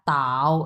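"তাও" drawn out in third tone; slow "তাও", dipping low. Shown as a demonstration. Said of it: "এটাও তিন number tone".

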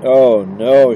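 loud, dismayed cry. A narrator's reading. Wife realising it is true, crying out. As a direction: cry out in dismay as the truth lands. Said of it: "she cried".

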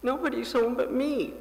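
put-on lonely little boy whimpering his answer; wailing. A narrator's reading. Little Paul tells the operator he's alone, mild wail. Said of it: "Paul wailed".

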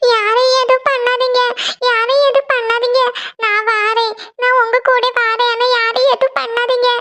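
high, dragged-out pleading in sleep; sleep-talking lament. Acted. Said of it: "இவ என்ன தூக்கத்துல புலம்பிட்டு இருக்கா".

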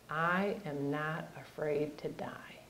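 hushed, unhurried quote of a son; retold quiet resolve. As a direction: say it softly and slowly, nearly under the breath. Quotes son's defiant calm answer.

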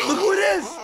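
high, loud excited yell; excited shout. Real, not acted. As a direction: greet them with a shout. Greeting a new guest.

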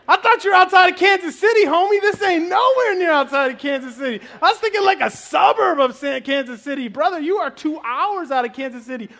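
incredulous. Retold or imitated. Retold disbelief about the distance.

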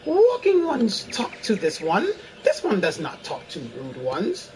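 high, airy fairy speaking formally; lilting fairy creature, aloof. Acted. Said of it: "my best fairy voice".

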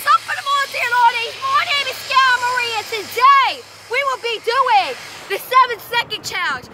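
high, hyped video host greeting; high-energy intro. Shown as a demonstration. Player showing friend her own intro, high and hyped. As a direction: belt out the intro with full intensity.

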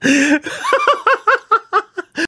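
long, high peal of laughter. Real, not acted. Winner asking at what cost, high laugh. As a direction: let a high laugh run on. Laughing after a correct prediction.

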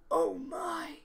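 sharp in-breath, then low "oh my"; gasped shock. Acted. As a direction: gasp as if running into a bear. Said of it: "gasped Mister Winky".

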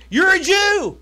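high, loud exclaiming woman; Samaritan woman. Retold or imitated. Preacher voices the Samaritan woman, high loud exclamation. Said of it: "The Samaritan woman said to him".

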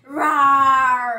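loud playful monster roar. Pretend.

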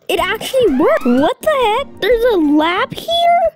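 high, rising disbelief; amazed. Pretend. Kid finds a hidden lab, amazed disbelief. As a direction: exclaim each phrase high and astonished.

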